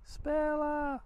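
second call, pitched well up. Real, not acted. Calls dog again higher.

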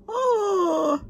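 high, drawn-out moan of delight; swooning delight. Real, not acted. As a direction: swoon over a favorite dessert. Swooning over rice pudding.

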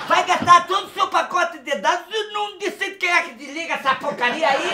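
normal talking voice

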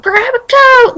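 mock urgent mom. Mom urging her daughter during a tornado, in a mock urgent shout. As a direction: push the repeat up like a panicking mom. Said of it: "like it was all serious".

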